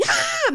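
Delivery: put-on loud, high baby shriek; imitated baby scream. Mother imitating her daughter's sudden outburst, shrieking baby cry. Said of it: "the baby's just screaming".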